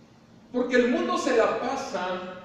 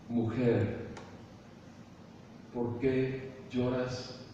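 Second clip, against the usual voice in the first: tender question; 8 semitones lower, 7 dB quieter, much slower than usual. Acted. Jesús habla a la mujer que llora.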